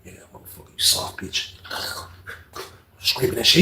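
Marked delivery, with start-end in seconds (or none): 0.0-1.5 whispered taunt. Retold or imitated. Taunting under his breath.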